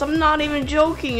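usual speaking voice

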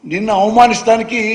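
ordinary delivery

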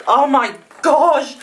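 breaking into a loud, overwhelmed cry; real gasp of delight turning into a cry. Real, not acted. Overwhelmed by a friend's gift, crying out.

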